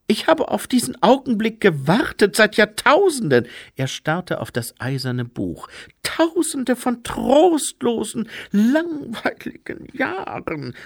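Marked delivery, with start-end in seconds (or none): 0.1-3.5 high, loud whine; plaintive, high-pitched lament; wailing. A narrator's reading. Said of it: "jammerte er". 6.0-10.8 murmured lament. A narrator's reading. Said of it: "murmelte er".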